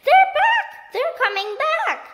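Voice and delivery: very high, loud scream, slowing on the second line; panicked screaming alarm. A narrator's reading. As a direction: shriek the warning in panic.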